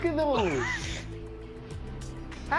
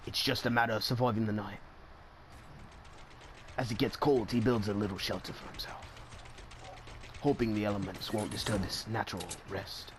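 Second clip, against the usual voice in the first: documentary narrator; 13 semitones lower, 7 dB quieter than usual. Acted.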